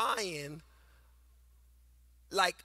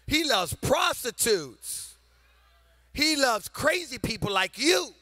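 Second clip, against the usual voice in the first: louder, rising preacher's build with long pauses; full-force preaching; 5 semitones higher, 6 dB louder than usual. Acted. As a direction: preach it to the whole room, building.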